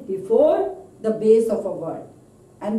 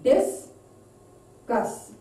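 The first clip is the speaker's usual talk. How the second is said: "Discuss" broken into two separated beats; syllable-by-syllable demo. Shown as a demonstration. Teacher showing how you put stress, "Discuss" split by syllable. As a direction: say "Discuss" as dis, long pause, cuss. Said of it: "how you put stress when you pronounce it".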